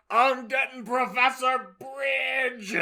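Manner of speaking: shrill threat. Acted. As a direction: storm out yelling. Fudge storms off shouting.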